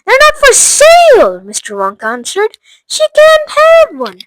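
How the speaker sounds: high, loud, final no; firm loud refusal. Acted. Wonka refuses the father, loud firm no. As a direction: shut her down sharply.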